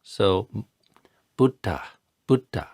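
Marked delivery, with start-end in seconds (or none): 1.4-2.7 Buddha with a puff of air on the dh; aspirated Buddha. Shown as a demonstration. Teacher modelling Pali Buddha, aspirated. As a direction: breathe on the dh in Buddha. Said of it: "there's a little bit of air".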